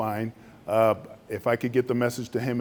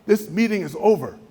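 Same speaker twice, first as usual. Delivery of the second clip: higher, ending the meeting; a hint of curt dismissal; 8 semitones higher than usual. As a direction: shut the meeting down. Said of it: "They go".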